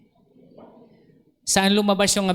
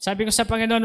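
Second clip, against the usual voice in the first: ordinary talk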